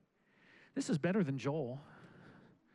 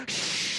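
mimicked shushing. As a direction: shush like a disapproving congregation. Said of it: "everybody's going".

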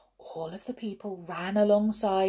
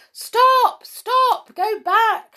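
very high, loud urgent yells; frantic calls to halt; crowd shouting; 10 dB louder, much slower than usual. A narrator's reading. Crowds yelling at the runaway engine, high shouts. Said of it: "shouted the crowds".

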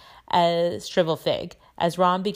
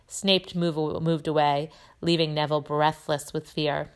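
ordinary delivery, nothing marked

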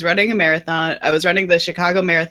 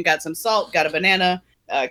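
normal talking voice

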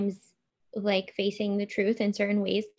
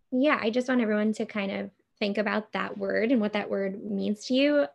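ordinary talk

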